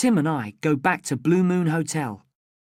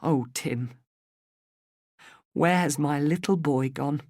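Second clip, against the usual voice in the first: tender. Acted. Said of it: "She gives him a small smile".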